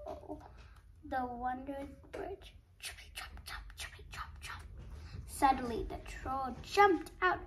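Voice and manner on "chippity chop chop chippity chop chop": hushed, voiceless whisper; very soft whispered trotting refrain; whispered hoofsteps. A narrator's reading. Hoofsteps repeated in a hush.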